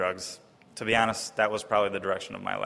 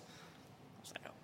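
whispered aside; 28 dB quieter, much slower than usual. Real, not acted. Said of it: "whispered aside".

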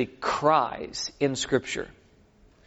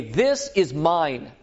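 very slow and deliberate; slow declaration; much slower than usual. Shown as a demonstration. Faith claiming enemy territory, slow firm declaration. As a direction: claim it word by word. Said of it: "plant the Lord's banner and say".

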